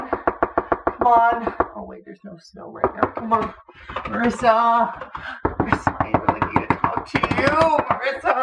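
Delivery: high, urgent pleading calls; panicked frantic pleading. Acted. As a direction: beg her to open up.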